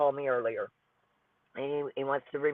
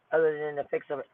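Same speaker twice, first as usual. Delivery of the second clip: ordinary talk, nothing marked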